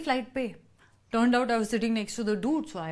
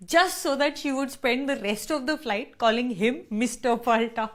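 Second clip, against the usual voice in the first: normal talking voice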